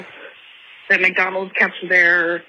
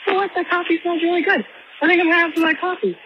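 customer voice; 9 semitones higher than usual. Retold or imitated. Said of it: "because people are like".

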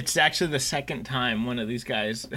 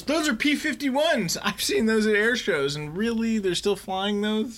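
a raised, wondering pilot line; pilot quote; 7 semitones higher than usual. Retold or imitated. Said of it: "the pilot goes".

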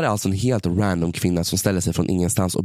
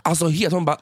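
usual speaking voice

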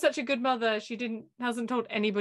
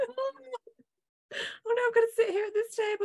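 mock wailing; 9 semitones higher than usual. Mocking Drew's discomfort, crying voice.

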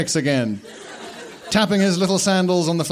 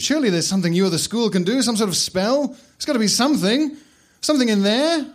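rising, quick, desperate begging; desperate pleading; 7 semitones higher than usual. Acted. Boy begs headmaster for a spell, desperate. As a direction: plead faster and higher as hope fades. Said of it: "gesturing desperately now".

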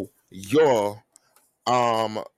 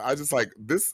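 ordinary talk, nothing marked

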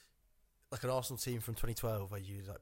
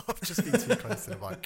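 higher and louder through a laugh; 10 semitones higher, 7 dB louder than usual. Real, not acted. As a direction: repeat it laughing at yourself.

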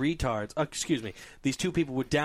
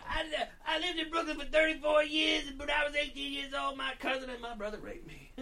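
high-pitched mock rant, trailing softer; ranting ferry lady; 12 semitones higher, 5 dB quieter than usual. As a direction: imitate her tangent in a high put-on voice. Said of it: "like, yelling and going on this tangent".

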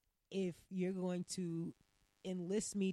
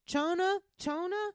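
high, loud calling out a name; 11 semitones higher, 8 dB louder than usual. Retold or imitated. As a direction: call the name across the room. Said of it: "they like".

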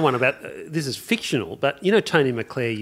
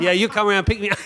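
higher, laughing imitation of a cheeky bloke on the phone; Guido voice; 7 semitones higher, faster than usual. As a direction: do the comic character calling again. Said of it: "in that fantastic voice he does".

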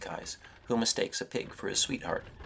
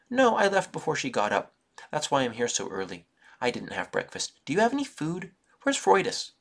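put-on high, loud little boy; chirpy and careless; eager child voice; 7 semitones higher, 6 dB louder than usual. A narrator's reading. Hungry little brother asking his sister for food, high and eager.

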